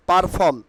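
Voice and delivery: "perform" said par-fom with a sounded r; the wrong "perform", first syllable "par"; common mispronunciation. Shown as a demonstration.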